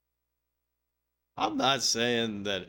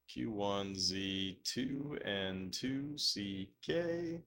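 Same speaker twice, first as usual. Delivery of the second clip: slow spelling out; 6 dB quieter, much slower than usual. Real, not acted. Reading a code out slowly.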